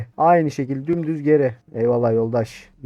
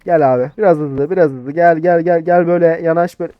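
loud, hurried call to come over; 3 semitones higher, 6 dB louder than usual. Acted. Officer calling the next driver to approach, calling out. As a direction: call the next one forward, louder.

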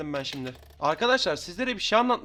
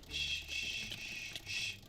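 shushing; 14 dB quieter, much slower than usual. Real, not acted. Sneaking up on enemies.

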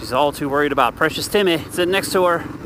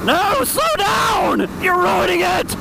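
frustrated shout; 13 semitones higher, slower than usual. Real, not acted. Driver yelling at a car ahead, frustrated shout.

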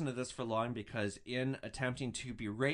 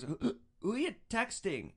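put-on drunk woman voice; 8 semitones higher than usual. Drunk woman confronting boyfriend.

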